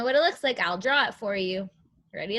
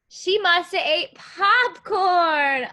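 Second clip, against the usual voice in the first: slow, higher, louder exclaiming; playful reveal; 7 semitones higher, 6 dB louder, slower than usual. Acted. Teacher revealing the lady's snack, playful. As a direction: draw it out for the kids.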